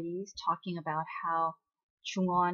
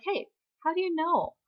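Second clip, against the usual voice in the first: high-pitched retold questioning; mock puzzled surprise; 10 semitones higher, 4 dB louder than usual. Recapper voices heroine questioning the caller, lightly startled. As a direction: act out a caught-off-guard question.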